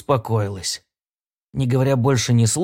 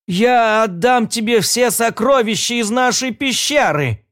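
pleading giantess; 8 semitones higher than usual. Acted. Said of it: "говорила великанша".

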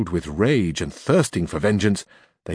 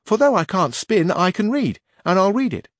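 high, louder page voice; young page voice, raised and loud; 9 semitones higher, 4 dB louder than usual. A narrator's reading. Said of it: "said the page".

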